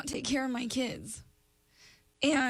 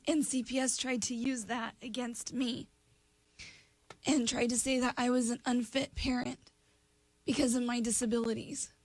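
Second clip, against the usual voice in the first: usual speaking voice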